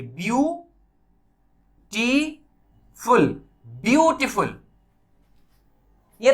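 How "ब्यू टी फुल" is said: ब्यू, टी, फुल spoken apart with pauses; ब्यू... टी... फुल, one beat each; syllables split. Shown as a demonstration. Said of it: "ये देखिए".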